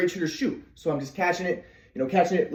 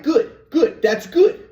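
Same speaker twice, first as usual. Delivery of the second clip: pleased dad; 7 semitones higher, 5 dB louder, much slower than usual. Retold or imitated. Dad praising new stance.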